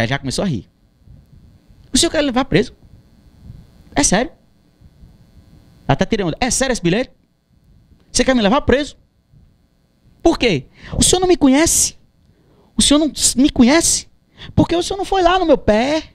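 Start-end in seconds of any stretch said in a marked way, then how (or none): 1.9-4.3 higher-pitched questioning, retold; incredulous challenge. Singer asks officer about being arrested, incredulous. 6.4-16.0 high-pitched, pleading challenge; indignant questioning. Retold or imitated. Singer confronts the officer who threatens arrest, indignant.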